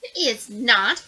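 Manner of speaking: loud, slow flat denial; dog barking a correction; barked. Acted. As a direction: bark the words at her. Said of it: "barked the dog".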